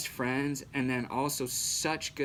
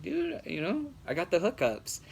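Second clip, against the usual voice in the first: a light, higher cocky buddy voice; smug friend; 6 semitones higher than usual. Retold or imitated. Friend brags about connections.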